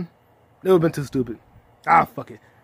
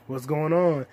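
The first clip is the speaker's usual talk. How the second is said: ordinary talk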